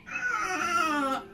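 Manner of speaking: high, long-held dragon call; thin, high sustained creature cry; gentler distant dragon cry. Acted. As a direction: answer as a gentle faraway dragon. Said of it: "a much gentler sort of".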